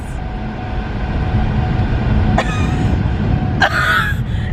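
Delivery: high squealing laugh, helpless; burst of laughter. Real, not acted. Owner bursts out laughing at her dog. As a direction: crack up at the dog.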